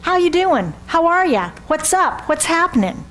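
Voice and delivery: light breezy small talk; casual greetings. Retold or imitated. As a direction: toss off quick casual greetings. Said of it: "What do we do?".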